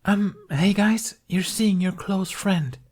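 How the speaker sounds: high, slow nudging aside; hint at the line through a forced smile; awkward stage prompt. A narrator's reading. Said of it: "Tooth prompting the actors".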